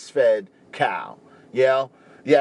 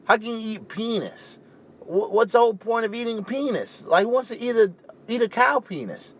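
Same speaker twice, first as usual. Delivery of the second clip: higher pitched, retold disgust; imitating his younger self, grossed out and incredulous; 6 semitones higher than usual. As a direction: recoil in mock horror at the idea. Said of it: "I was like".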